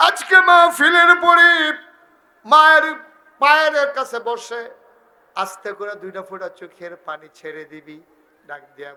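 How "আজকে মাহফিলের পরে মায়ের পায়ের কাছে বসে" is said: high, loud sermon shout; full-force preaching. Real, not acted. Preacher urging sons to sit at their mothers' feet, shouted exhortation. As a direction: cry it out to the crowd, much higher and louder.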